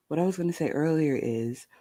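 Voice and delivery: soft sleepy ASMR murmur; hushed, drowsy, just out of bed; just-woke-up ASMR voice. Shown as a demonstration. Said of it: "my I just woke up A S M R voice".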